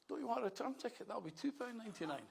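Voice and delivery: high, chirpy mock kiosk lassie; a lightly voiced ticket lassie offering a return. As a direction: lift the pitch to play the helpful ticket seller.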